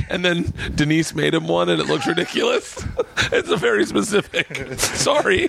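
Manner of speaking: high-pitched, breaking into real laughter; laughing through words. Real, not acted. Guest recalling the Cosby Show shirt, laughing helplessly. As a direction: try to explain while cracking up. Cracking up at own TV reference.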